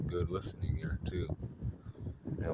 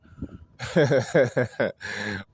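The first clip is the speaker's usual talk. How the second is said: a short burst of real laughter, higher and louder; 5 semitones higher, 7 dB louder than usual. Real, not acted. Laughing at herself over her dry Taurus moves, real laugh. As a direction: laugh it off, amused at yourself.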